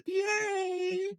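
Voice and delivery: very high, drawn-out squeal of a cheer. Real, not acted. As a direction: squeal it out in celebration. Cheering the winning play.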